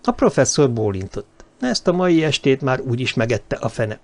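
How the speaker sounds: ordinary delivery, nothing marked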